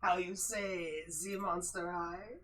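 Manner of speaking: slow, hammed-up French accent; mock French accent, groping for words. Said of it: "I gotta bring back my French voice".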